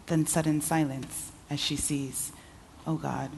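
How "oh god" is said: usual speaking voice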